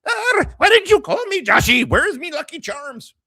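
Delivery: very high, exaggerated Irish mother; mock Irish mom. Joking about how his Irish mom would sound, cartoon Irish accent. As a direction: put on a hammed-up, high-pitched Irish accent. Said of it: "Even though she's Irish".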